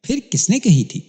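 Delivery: put-on astonished question to the boy; surprised. A narrator's reading. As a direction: ask in open astonishment. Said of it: "حیرت بھرے لہجے میں سوال کیا".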